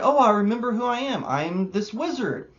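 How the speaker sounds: high, fast, hammed-up sudden realization; mock wizard line. Reviewer rewriting the wizard's reveal, mock realization. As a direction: toss it off as a silly self-discovery. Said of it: "Why couldn't it have been".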